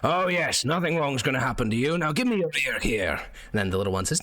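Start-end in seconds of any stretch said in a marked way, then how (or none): 0.0-3.1 a mocking puppet soldier playing it up; armored puppet. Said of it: "he says".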